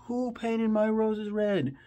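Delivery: high, slow imperious queen voice; Queen of Hearts line. Retold or imitated. Quoting the Queen of Hearts in a high imperious voice. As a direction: demand it like an outraged queen.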